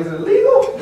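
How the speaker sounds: very high astonished question; surprised. Retold or imitated. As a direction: squeak it in disbelief. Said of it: "I was like".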